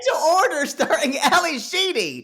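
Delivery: high, loud mock announcement; playful movie plug. Host joking about a mistaken movie title, playful announcing.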